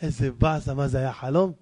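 low, deflated waking voice; waking letdown. Acted. Man wakes from the dream, low disappointed grumble. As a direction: drop low, just woken and let down.

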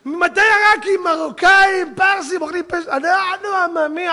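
high, loud protesting shout; indignant comic rant. Acted. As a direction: rattle off a folksy protest at the top of the voice. Comic indignant tirade about eating. (נאום מחאה קולני ומצחיק)

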